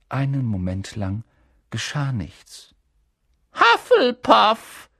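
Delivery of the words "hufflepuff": high, loud, slow hat voice; the hat proclaims the house; hat calls out. A narrator's reading. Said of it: "rief der Hut". (der Hut ruft laut das Haus aus)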